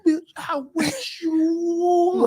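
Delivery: slow, high, laughing singer voice; R. Kelly impression. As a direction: drag it out high and shouting through laughs. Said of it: "He's like".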